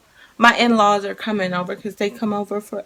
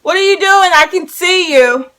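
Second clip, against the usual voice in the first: calling out; 9 semitones higher, 12 dB louder than usual. Real, not acted. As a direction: shout across the house to them. Calling out to kids.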